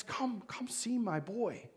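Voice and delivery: hushed, breathless plea; soft whispered begging. Acted. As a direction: whisper it like a desperate father. Father pleads quietly.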